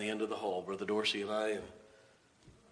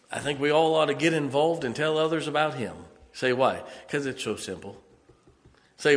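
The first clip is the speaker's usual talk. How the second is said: full-force preaching; 4 semitones higher, 9 dB louder than usual. Acted.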